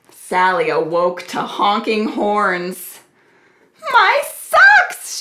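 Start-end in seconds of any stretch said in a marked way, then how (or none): 3.9-5.2 loud, very high exclamation; dismayed storybook shout; crying out. A narrator's reading. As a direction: cry out in high-pitched alarm. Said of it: "she cried".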